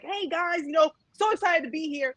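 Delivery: high, chirpy streamer voice; put-on perky host voice; livestream greeting. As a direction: mimic yourself greeting viewers in a high pitch. Imitates herself mid livestream.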